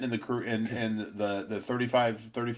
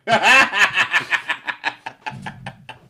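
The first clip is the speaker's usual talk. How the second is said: big burst of real laughter; loud laugh; 7 semitones higher, 11 dB louder than usual. Real, not acted. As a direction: laugh out loud, high and hearty. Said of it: "laughing at the visual aid".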